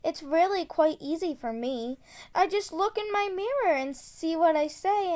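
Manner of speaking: ordinary delivery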